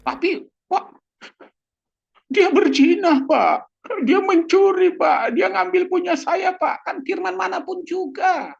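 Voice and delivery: high, loud, pleading complaint to the pastor; complaining congregant, high and insistent; whiny complaint. Acted.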